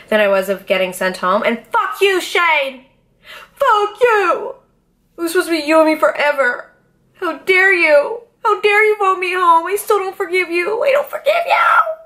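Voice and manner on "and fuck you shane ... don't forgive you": higher, hammed-up crying tirade at Shane; mock tearful outburst that breaks into a cry-shout at the end; mock sobbing rant. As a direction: wail it like a betrayed lover, half laughing. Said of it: "So I weep".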